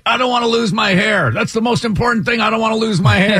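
high, rushed imitation of a man close to tears; hammed-up tearful panic, pitched right up; mock near-crying. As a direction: plead in a put-on high whine, panicking. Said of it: "this guy's almost crying at this point".

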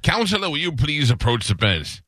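lightly voiced judge summoning counsel. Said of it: "The old Southern judge".

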